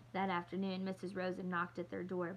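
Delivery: ordinary delivery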